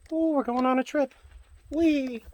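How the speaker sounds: high, loud ride-along whee; playful excitement. Pretend. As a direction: swoop it up like a kid on a ride. Lifting toy car like a ride.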